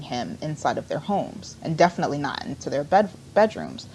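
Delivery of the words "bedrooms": ordinary delivery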